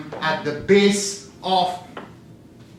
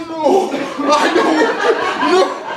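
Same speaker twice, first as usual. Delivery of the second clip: protesting no; 10 semitones higher, 5 dB louder, much slower than usual. Retold or imitated. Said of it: "And I said".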